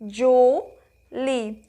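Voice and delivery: "Julie" very slow, a long pause between Ju and lie; syllable by syllable. Shown as a demonstration. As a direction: stretch "Julie" into two separate syllables. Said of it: "eu abro a boca". ("Julie" bem devagar, sílaba por sílaba)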